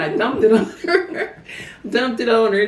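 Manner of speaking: amused, laughing through the words; giggly confession of mischief. Real, not acted. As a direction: tell the prank while cracking up. Laughs telling childhood prank.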